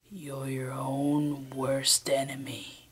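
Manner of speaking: slow breathy whisper; sinister whisper. Acted. Villain whispers the full line in the final take. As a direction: whisper the threat slowly.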